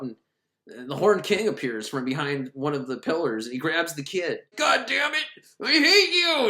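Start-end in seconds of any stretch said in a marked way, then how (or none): 4.5-6.5 high, hammed-up angry yell; mock fury. Villain grabbing the kid, hammed-up angry yell. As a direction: throw a cartoonish tantrum. Horned King grabs the kid.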